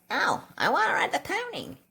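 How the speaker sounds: put-on high whiny little boy voice; whiny kid. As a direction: pitch it up like a pleading child. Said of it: "And I was like".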